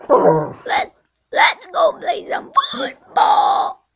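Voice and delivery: high, slow breathy screeching shout; pterodactyl shriek. Acted. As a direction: drag out a wild reptile screech. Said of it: "Okay, third".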